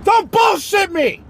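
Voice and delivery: high, loud insistent shouting; hammed-up incredulous yelling; disbelieving American. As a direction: badger them at full voice. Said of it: "the Americans are like".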